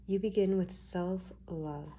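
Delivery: slowed down, laying out each word; slow emphatic repeat. Shown as a demonstration. Reader to viewers, slowly stressing self-love. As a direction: say it again slowly so it lands.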